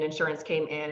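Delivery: usual speaking voice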